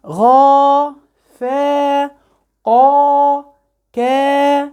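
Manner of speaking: ظا، عام، غا held long on a high level note; stretched letters. Shown as a demonstration. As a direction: chant ظا to وا one by one, each vowel drawn out. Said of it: "علي الصوت". (مدّ الحروف من ظا إلى وا بنغمة طويلة)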